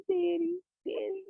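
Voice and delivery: put-on high little baby voice. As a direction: coo it like a small child. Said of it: "having these little baby voice".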